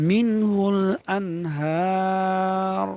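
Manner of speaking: আনহার with long হা and a full final r; slow মিনহুল আনহার, closing on a heavy r. Shown as a demonstration. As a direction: stretch হা, then round off আনহার with full r. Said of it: "র পুর হবে".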